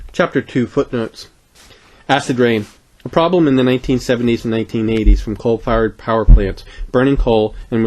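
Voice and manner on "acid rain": ordinary delivery, nothing marked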